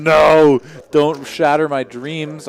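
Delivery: loud, slow mock despair; mock anguish. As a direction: wail it like a heartbroken fan. Mock despair over views.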